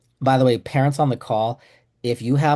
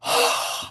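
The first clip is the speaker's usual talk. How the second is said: exaggerated delighted sigh. Dentist finds the mess.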